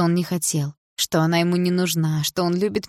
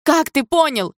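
much higher, loud outcry; cried out; 9 semitones higher, 6 dB louder, slower than usual. A narrator's reading. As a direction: shout it in disbelief. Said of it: "выкрикнула мама".

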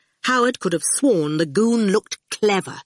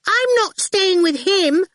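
put-on much higher, a child's demand; lightly voiced little girl; bossy little girl; 14 semitones higher, 4 dB louder than usual. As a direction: pitch it up like a cheeky small girl. Girl Awful voiced in story.

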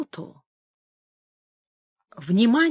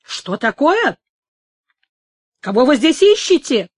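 loud, higher indignant demand; challenging cry; 4 semitones higher, 6 dB louder than usual. A narrator's reading. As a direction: challenge an intruder, raising the voice. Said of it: "вскричал, хватаясь за шпагу, Мануэль". (гневный громкий окрик)

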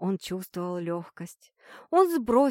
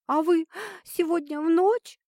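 tearful, sniffling question; 8 semitones higher, 3 dB louder than usual. Acted. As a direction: ask through sniffles, close to tears. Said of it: "всхлипывающий голос". (всхлипывая, сквозь слёзы)

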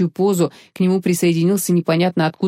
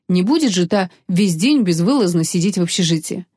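plain reading voice

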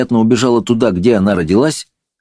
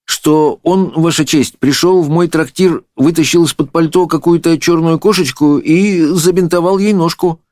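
high-pitched, deferential testimony; lightly voiced nervous witness; timid innkeeper; 10 semitones higher than usual. A narrator's reading. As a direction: give meek evidence to the judge. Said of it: "робко отвечал трактирщик".

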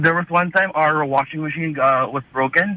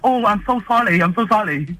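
higher-pitched mimicked Cantonese-accented English; 5 semitones higher than usual. Chinese technician apologising to the mom, in a Cantonese accent. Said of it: "in his, uh, Cantonese, um, accent".

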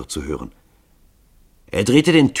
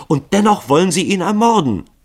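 much higher, louder indignant cry; outraged interruption; 13 semitones higher, 8 dB louder than usual. A narrator's reading. Grunja indignantly interrupting Lycoville. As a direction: cut in with outrage.

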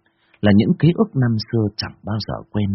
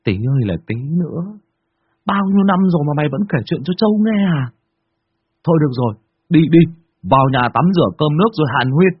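teasing friend; 7 semitones higher, 3 dB louder, faster than usual. Acted. Đen teasing friend, laughing.

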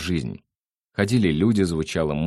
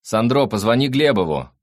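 loud, raised reminder; calling out; 6 semitones higher, 6 dB louder than usual. A narrator's reading. Nick shouts after his brother to phone someone, calling out. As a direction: call the instruction after him.

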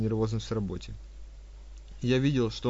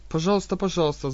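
higher hurried fawning; 7 semitones higher, 3 dB louder than usual. Acted. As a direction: rush it out eagerly. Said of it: "заторопилась она".

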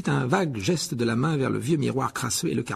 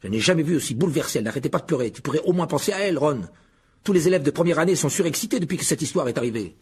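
heated, raised-pitch reproach; fierce scolding; 4 semitones higher than usual. Acted. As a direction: lecture him indignantly. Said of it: "dit Percy d'un ton féroce". (sermon féroce et indigné)

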